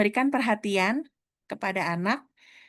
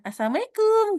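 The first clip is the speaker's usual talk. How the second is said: Assalamualaikum sung out much higher and louder; shrill-free high cheerful Assalamualaikum, higher and louder; high, loud greeting; 13 semitones higher, 6 dB louder than usual. Shown as a demonstration. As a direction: call Assalamualaikum out brightly, pitched way up and louder. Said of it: "kayak gitu aja udah beda ya".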